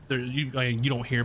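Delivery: ordinary talk, nothing marked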